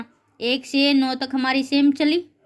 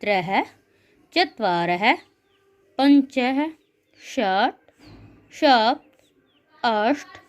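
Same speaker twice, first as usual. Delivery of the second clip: lower, measured chant of the numbers, one word at a time; recitation drill; 3 semitones lower, much slower than usual. Shown as a demonstration. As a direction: lead the class through the count, word by word. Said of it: "एक बार हम दोबारा एक बार उच्चारण कर लेते हैं".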